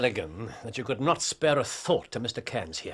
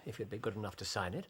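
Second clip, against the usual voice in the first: ordinary delivery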